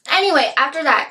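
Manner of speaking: ordinary delivery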